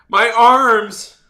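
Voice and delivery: worked-up wail of protest; exasperated outcry. Retold or imitated. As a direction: cry out about your aching arms. Acting out exhausted protest, loud.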